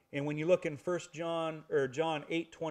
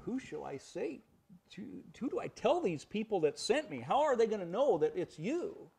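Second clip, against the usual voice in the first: lightly acted, higher-pitched pleading questions; questioning Moses; 5 semitones higher than usual. Moses asking God at the burning bush, lightly acted puzzlement. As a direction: imitate a puzzled man asking for help.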